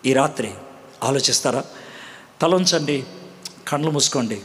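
preacher's hushed invitation to pray; altar call. Acted. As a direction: draw them gently to repent.

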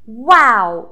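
ordinary delivery

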